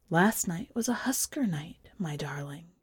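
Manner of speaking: hushed, unvoiced whisper to her husband. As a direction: whisper it close in the dark.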